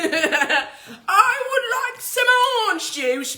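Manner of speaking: high, slow mock-posh English lady, laughing; proper old English woman. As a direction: drawl it like a prim old Englishwoman. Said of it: "Like a proper old English woman".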